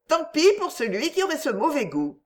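lively retort. A narrator's reading. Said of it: "répliqua-t-elle vivement". (réplique vive, voix plus aiguë)

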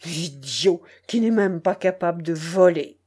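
scornful outburst in an altered voice; contemptuous cry. A narrator's reading. Wounded Tchelkash insults his would-be robber, contemptuously. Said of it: "cria Tchelkash méprisant".

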